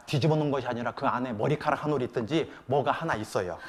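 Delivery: normal talking voice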